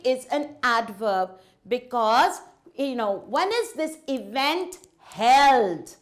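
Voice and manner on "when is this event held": slowly, leaning hard on "held" at the end; stress on HELD. Shown as a demonstration. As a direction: draw out and punch the word "held". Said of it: "My emphasis is on the word held".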